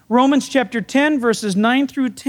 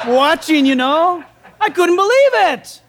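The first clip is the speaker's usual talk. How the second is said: can't hold back the laughter; laughing; 3 semitones higher than usual. Real, not acted. Son recalling his mother's prayer, laughing.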